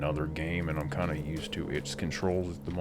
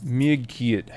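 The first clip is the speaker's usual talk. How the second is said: hammy fake Russian accent, louder; bad Russian accent; 10 semitones higher, 6 dB louder than usual. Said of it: "I always gotta say that with a bad Russian accent".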